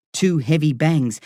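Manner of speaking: usual speaking voice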